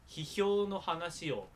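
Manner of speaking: normal talking voice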